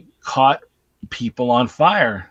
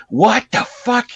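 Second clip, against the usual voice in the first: high, drawn-out mock shock; slow, high-pitched retold "what the fuck"; startled outburst; 9 semitones higher, slower than usual. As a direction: act out a victim's startled disbelief. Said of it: "It's like".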